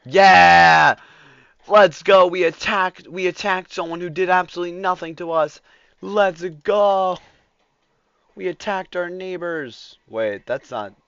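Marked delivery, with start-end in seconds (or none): 0.0-10.0 loud gleeful cheers; excited cheering. Real, not acted. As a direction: cheer it wildly. Cheering the attack.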